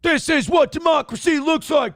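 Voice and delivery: high mock protest chant; raw throat-tearing yells. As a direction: chant it like a rally crowd.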